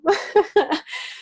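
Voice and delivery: bright high laugh. Real, not acted. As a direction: laugh at being proven wrong. Laughing after his "I told you.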